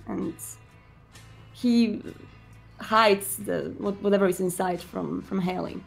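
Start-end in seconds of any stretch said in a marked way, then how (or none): none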